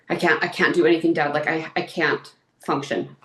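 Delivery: usual speaking voice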